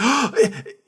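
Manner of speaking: sharp acted intake of breath; high theatrical gasp; hopeful gasp. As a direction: gasp in sudden mock hope. Wife gasps with hope.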